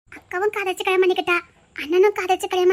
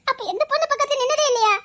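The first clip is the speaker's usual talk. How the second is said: teasing, laughing; 7 semitones higher than usual. Acted. Girl teasing the shy man through laughter. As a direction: ask it through a mocking laugh, pitched up. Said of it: "நக்கல் பண்ணி சிரிக்காத".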